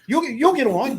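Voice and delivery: mock his smug dismissal; mocking brush-off. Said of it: "He's like".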